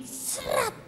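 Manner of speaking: whispered swish of a blade; slicing noise. Retold or imitated. Acting out the cutter on the wrist, imitated slash. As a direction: make a voiceless quick slash sound.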